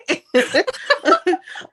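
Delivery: high delighted burst of laughter. Real, not acted. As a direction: laugh out loud with glee. Laughs after boast.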